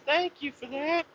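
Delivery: high, soft mock thank-you; office lady. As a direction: squeak out a polite thank-you. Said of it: "office lady that was there was saying".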